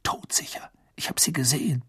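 hushed, breathy whisper. Acted. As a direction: keep it secret, whispered. Secret talk continues whispered.

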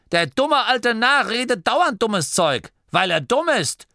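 high, emphatic scolding; scolding granny. A narrator's reading. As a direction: berate him in a high granny voice. Granny scolds the old man. (schimpfende, hohe Oma-Stimme)

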